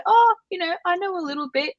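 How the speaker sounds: high-pitched, acted modest answer; modest reply. Voices learner's modest reply to crew.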